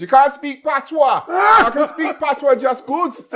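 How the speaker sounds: high, loud laughing Jamaican Patois; imitating mum's Patois. Daughter mimicking her mother's Patois, laughing. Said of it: "My mum always says".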